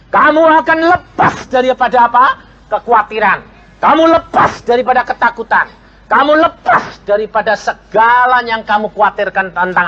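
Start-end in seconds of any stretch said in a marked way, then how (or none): none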